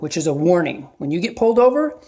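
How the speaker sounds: ordinary delivery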